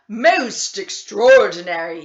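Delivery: astonished doctor bursting out; exclaiming. Acted. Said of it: "he exclaimed".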